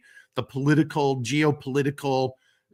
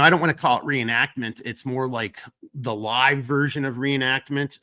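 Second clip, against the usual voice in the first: normal talking voice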